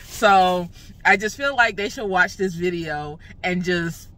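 normal talking voice

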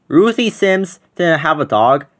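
ordinary delivery

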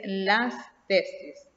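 "las tesis" said very slowly, leaning on "las"; teacher spelling out the plural for students; slow teaching stress. Shown as a demonstration. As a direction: stretch "las tesis", punching "las". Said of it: "La tesis".

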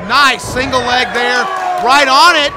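loud, sudden burst of excitement; excited shout. Real, not acted. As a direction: shout it as the takedown lands. Sudden takedown during live call.

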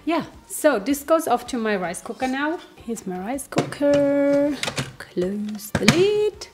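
normal talking voice

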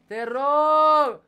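high, loud begging cry; pleading shout; desperate plea. Real, not acted. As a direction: beg at full voice, stretching it out. Begging the game at a jump.

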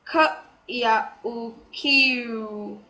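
"k ya u q" slowly, sound by sound; slow teacher's demo of "k ya u q"; phonetic sounding-out. Shown as a demonstration. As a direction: stretch "k ya u q", the J said as a Y glide. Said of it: "the j sound is pronounced as y".

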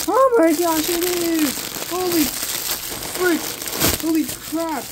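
real high, loud burst of excitement; excited screaming. Real, not acted. Fan seeing the awaited plushies in the package, excited screaming. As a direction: cry out in high-pitched excitement. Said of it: "screaming so loud right now".

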